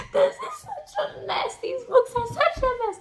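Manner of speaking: gleeful fangirl squeal; delighted squeal. Real, not acted. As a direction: swoon over the mess with glee. Gushing over the book.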